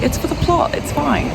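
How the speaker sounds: high chirpy self-reassurance; mock self-reassurance. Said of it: "I just tell myself".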